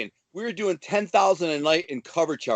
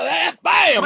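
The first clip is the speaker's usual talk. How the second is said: much higher, loud "Ah, bam!" for the knockout punch; punch shout; 12 semitones higher, 5 dB louder than usual. Retold or imitated. Retelling knocking a guy out, loud punch shout. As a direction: yell it like the punch connects.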